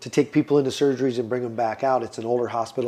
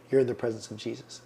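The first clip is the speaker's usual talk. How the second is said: soft, awed, much quieter line; hushed inner voice; 2 semitones lower, 9 dB quieter than usual. Retold or imitated. Patient recalling a voice in his head, hushed and awed. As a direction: say it like a sacred revelation.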